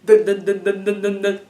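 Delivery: loud, rapid-fire "dun" piano pounding; intense piano imitation. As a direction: hammer out repeated piano notes on one pitch. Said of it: "this very intense".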